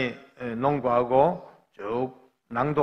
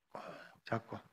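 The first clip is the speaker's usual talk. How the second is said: near-whisper, barely voiced; hushed; 4 semitones lower, 19 dB quieter than usual. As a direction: let it fade to a whisper. Holding son's hand at execution. (속삭이듯 조용하게)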